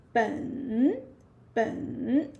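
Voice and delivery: 本 drawn out, dipping then rising; third tone. Shown as a demonstration. Teacher introducing the next word, 本 in dipping third tone. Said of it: "third tone as well".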